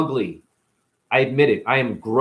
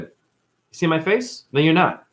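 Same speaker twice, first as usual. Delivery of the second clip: ordinary delivery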